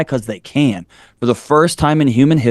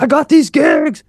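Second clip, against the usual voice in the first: much higher, slow, whooping announcement; excited cheer; slower than usual. Retold or imitated. Telling his wife about the big gigs, mock excited cheer. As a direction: crow the good news like a win.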